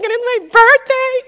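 very high, loud crying whine; mock tearful wail. Upset customer crying to mall security, high mock wail. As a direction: wail it like an overwrought crier. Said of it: "I'm starting to cry".

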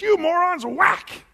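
high, loud mock fury; hammed-up enraged Moses; angry outburst. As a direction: shout it as you strike. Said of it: "in anger. And he says".

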